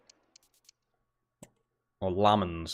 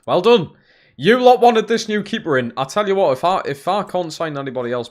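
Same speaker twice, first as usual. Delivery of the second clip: loud, higher, mock congratulations; sarcastic rant; 7 semitones higher, 6 dB louder than usual. Real, not acted. Blaming chat for the expensive keeper, loud sarcasm. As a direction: sneer well done at them.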